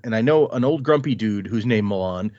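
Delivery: normal talking voice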